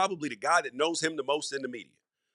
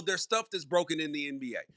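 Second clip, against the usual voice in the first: usual speaking voice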